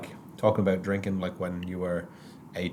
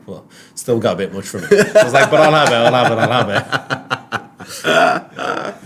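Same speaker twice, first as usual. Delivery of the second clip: laughing reluctant acceptance; 3 semitones higher, 7 dB louder than usual. Retold or imitated. Grudgingly accepting the beer, laughing.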